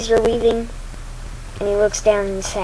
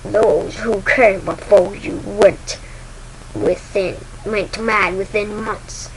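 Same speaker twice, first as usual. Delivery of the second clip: very slow, measured villain voice; deliberate words from a dark master; Darth Vader; slower than usual. Acted. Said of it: "says Vader".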